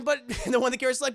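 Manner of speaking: ordinary talk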